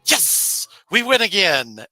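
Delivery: hammed-up celebration; mock triumph. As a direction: crow it like a victory.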